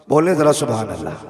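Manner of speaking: loud urging shout. Real, not acted. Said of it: "और जोर से".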